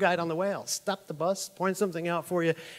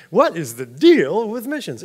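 drawn-out, louder comic's setup line; stand-up comedian cadence; Seinfeld impression; 3 semitones higher, 6 dB louder than usual. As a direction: stretch it like a stand-up observational bit. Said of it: "that's where I get a lot of my".

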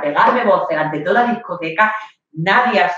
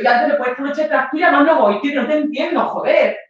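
high, frustrated yell; yelling after him; 8 semitones higher than usual. Retold or imitated. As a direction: shout after him as he walks away.

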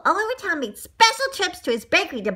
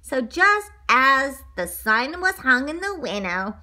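usual speaking voice

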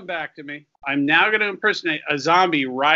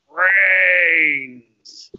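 zombie voice; 5 dB louder than usual. Retold or imitated. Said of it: "when any zombie says".

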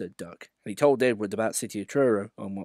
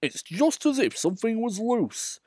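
much higher uneasy inner voice; worried Gordon thinking; 12 semitones higher than usual. Acted. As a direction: think it anxiously. Gordon's thought.

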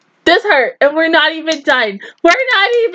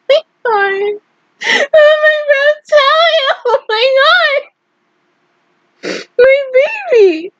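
real crying; 11 semitones higher than usual. Real, not acted. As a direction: break down sobbing over a lost favourite. Crying over character's fate.

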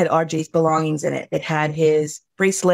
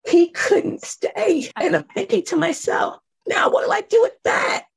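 much higher, straining voice; anguished disbelief; 7 semitones higher than usual. Retold or imitated. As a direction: ask it as if the ground fell away, voice climbing high. Anguished reliving.

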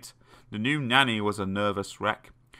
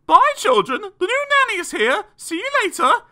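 loud, very high sing-out mum; high-pitched hurried farewell; mother calling goodbye; 8 dB louder than usual. A narrator's reading. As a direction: call out brightly on the way out.

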